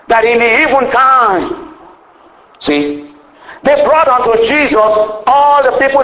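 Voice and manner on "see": usual speaking voice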